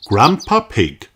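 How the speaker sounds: ordinary delivery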